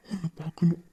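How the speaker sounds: a yearning whisper to the absent beloved; longing whisper. Acted. Lover longing for lost beloved.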